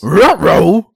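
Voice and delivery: high, loud cartoon dog "ruh-roh"; Scooby-Doo voice. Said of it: "do my Scooby-Doo impression".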